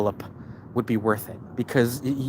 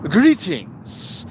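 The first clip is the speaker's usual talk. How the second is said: mock formal greeting; 5 semitones higher, 3 dB louder than usual. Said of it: "that's too familiar".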